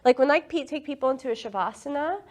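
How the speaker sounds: normal talking voice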